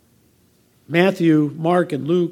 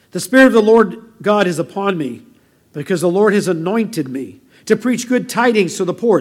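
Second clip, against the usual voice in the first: louder, declaimed Bible reading; scripture reading; 2 semitones higher, 7 dB louder than usual. Acted. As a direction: proclaim the verse to the congregation. Reads scripture aloud to congregation.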